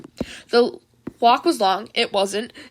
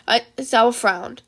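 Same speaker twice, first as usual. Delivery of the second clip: ordinary delivery, nothing marked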